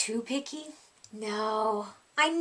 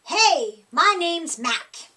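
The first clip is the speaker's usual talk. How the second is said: loud, slow friendly monster greeting; 5 semitones higher, 7 dB louder, slower than usual. A narrator's reading. Scruffy monster greeting the child under the bed. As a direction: boom out a slow hello as a shaggy monster. Monster Mac introduces himself.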